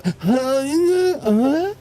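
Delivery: a high breathy moan-laugh. Acted. Demonstrating his wistful moan, high silly moan. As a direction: let out a silly demo moan. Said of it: "It's like, when I go".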